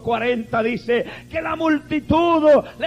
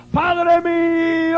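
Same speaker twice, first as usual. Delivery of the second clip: shouted high and slow to the Father; anguished cry; 6 semitones higher, much slower than usual. Acted. Jesus alone on the mount crying out to his Father, high anguished shout.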